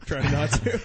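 laughing through a held burp. Real, not acted. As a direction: giggle while talking. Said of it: "Sorry, I'm burping".

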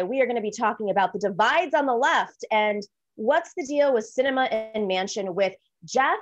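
usual speaking voice

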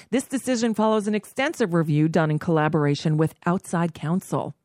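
rapid, rushed reading; fast memo read. Acted. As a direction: rattle off the memo quickly.

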